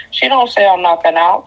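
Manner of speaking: ordinary delivery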